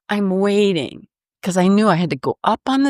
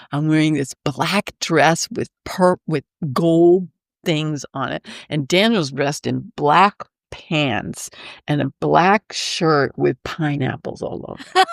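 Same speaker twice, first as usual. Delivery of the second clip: ordinary talk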